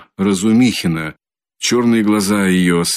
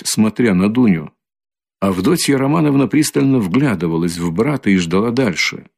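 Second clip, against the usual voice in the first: usual speaking voice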